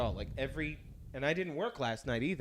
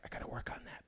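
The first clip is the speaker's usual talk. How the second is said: whisper; 12 dB quieter, faster than usual. Retold or imitated. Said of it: "I'm like".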